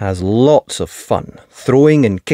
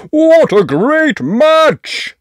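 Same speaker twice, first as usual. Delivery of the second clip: high slow booming cheer; much slower than usual. Acted. Daddy Pig cheering after the muddy match, joyful. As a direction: cheer it out happily. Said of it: "cheers Daddy Pig".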